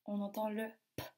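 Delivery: normal talking voice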